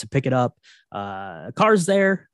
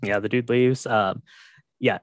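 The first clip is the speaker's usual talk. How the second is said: ordinary delivery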